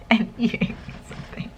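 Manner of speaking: whispered with a laugh; soft whisper. Real, not acted. Student joking to the camera, laughing whisper. Laughing aside after crying.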